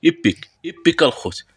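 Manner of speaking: high, loud excited cry over the pull. Real, not acted. Pack opener cheering at pulling an epic card, loud and high. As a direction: burst out with joy.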